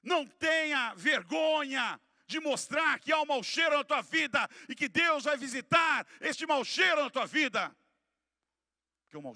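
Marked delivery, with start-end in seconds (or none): 0.0-7.8 loud, high-pitched preacher's cry; full-force preaching. Real, not acted. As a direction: preach it at full volume, hammering each phrase.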